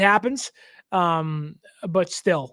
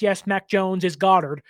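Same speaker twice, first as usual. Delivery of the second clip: ordinary talk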